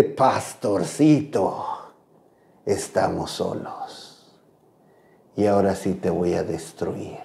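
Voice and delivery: low, slow demonic voice; menacing demon, deep and slow; silky menacing villain. Retold or imitated. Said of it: "se le transformó la voz. Era una voz realmente horrorosa".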